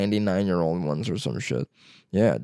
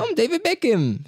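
mock Beckham. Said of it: "someone go".

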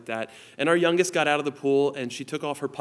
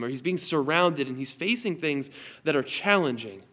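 ordinary delivery

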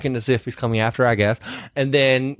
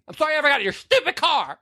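high, fast melodramatic Vivian; bad acting; 10 semitones higher, much faster than usual. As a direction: overact the heroine's tearful outburst. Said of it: "You're doing the whole thing right now".

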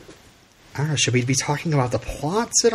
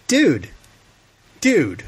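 incredulous dude; 9 semitones higher, slower than usual. Retold or imitated. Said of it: "it's just like".

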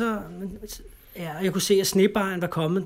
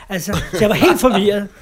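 laughing; 8 dB louder than usual. Real, not acted. Laughing at his own confusion.

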